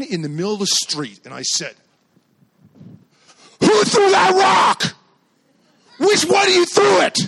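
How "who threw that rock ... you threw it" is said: high, full-force angry yell; furious dad shouting. Retold or imitated. Dad confronting rock-throwing kids in the street, furious shout. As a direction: bellow it across the street in a rage.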